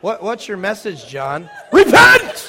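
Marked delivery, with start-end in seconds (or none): 1.7-2.5 high shouted repent, hammed-up; mock prophet cry. Preacher mimicking John answering question, hammed-up shout. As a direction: bellow it like a desert prophet. John the Baptist imitated again.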